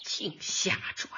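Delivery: whispered, dismissive mutter; under-breath grumble. Acted. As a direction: grumble it under your breath so he barely hears. Muttered aside after argument.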